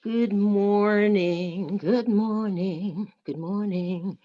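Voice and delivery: very slow, drawn-out good morning; slow stretched welcome; slow greeting. Acted.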